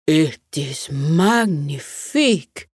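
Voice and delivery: slow French bear voice; French accent. Acted. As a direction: say it like a proud Frenchman.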